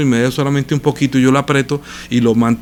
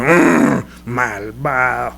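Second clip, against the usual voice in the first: mocking accuser; 4 semitones lower, much slower than usual. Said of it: "dígale a usted a un pastor".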